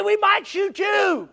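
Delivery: high, drawn-out warning call; storyteller imitating a friend shouting upward; retold holler back. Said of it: "Jerry hollered back".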